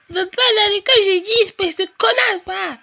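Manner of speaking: put-on a four-year-old hurling an insult; toddler tantrum. Sophie insults sister. (petite fille en colère qui crie)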